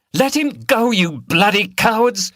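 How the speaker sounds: high, loud furious shout. A narrator's reading. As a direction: yell across at the beaters. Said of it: "shouted Liz".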